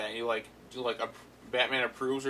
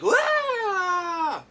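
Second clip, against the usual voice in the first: high, loud cartoon villain yelp; Joker scream; 7 dB louder than usual. Joker is kicked off the coaster and yelps high and loud as he falls. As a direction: yelp like a villain falling into the water. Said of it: "I just love when Mark Hamill screams as the Joker".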